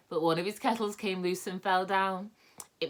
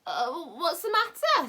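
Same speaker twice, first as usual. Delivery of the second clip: slow, higher, just-woken voice; sleepy voice; 13 semitones higher, 6 dB louder than usual. A narrator's reading. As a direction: pretend you were fast asleep. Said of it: "said Moonface in a sleepy voice".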